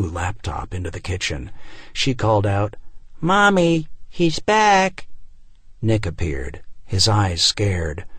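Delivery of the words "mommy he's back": put-on high child's voice calling mom; a young daughter calling out to her mother; little girl calling. Daughter calls to mom about dad, high child voice.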